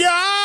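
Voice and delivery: high startled ah; mock startled yelp. Improviser on stage reacting in a scene, high yelp. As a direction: yelp as if caught off guard. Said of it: "I was like".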